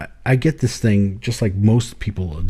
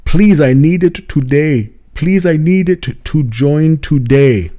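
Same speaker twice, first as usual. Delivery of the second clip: put-on slow, pleading African accent, a bit louder; imitating an African accent; 5 semitones higher, 5 dB louder, slower than usual. Zambian man begging to join Satanists, slow pleading African accent. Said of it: "But if I do this, then it's him".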